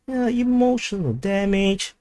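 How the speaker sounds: high, slow mock-wounded cry; mock emotional damage. Streamer mocking hurt at a chat insult. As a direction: ham up being hurt.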